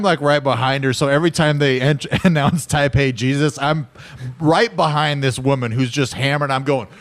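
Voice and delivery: ordinary talk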